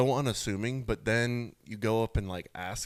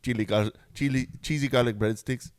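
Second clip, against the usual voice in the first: put-on Swedish accent; 3 semitones higher, 5 dB louder than usual. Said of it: "Was that a Swedish accent?".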